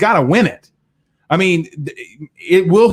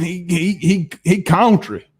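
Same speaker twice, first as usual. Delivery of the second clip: put-on country accent; slower than usual. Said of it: "using my country accent".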